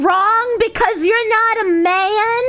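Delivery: high whiny outraged commenter voice; shrill mock outrage, rising to a yell; mock angry commenter. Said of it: "People being like".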